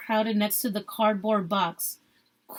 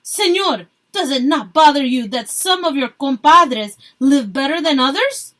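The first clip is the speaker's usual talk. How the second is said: loud challenge, pitched up; yelled; 3 semitones higher, 7 dB louder than usual. A narrator's reading. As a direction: shout the question at him. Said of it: "yelled one of Marta's friends".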